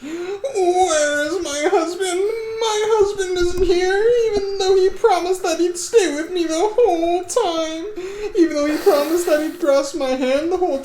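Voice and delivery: high, loud hammed-up distressed wife; tearful, high-pitched panicked cry; panicked wailing. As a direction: wail in panic, calling out for your husband. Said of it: "they heard Ava's panicked voice".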